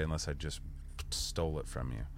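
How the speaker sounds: ordinary talk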